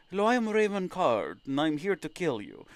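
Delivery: softly menacing bounty hunter intro, lightly voiced; a hint of a filtered killer's voice; intended villain voice. Shown as a demonstration. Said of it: "Supposed to sound like that".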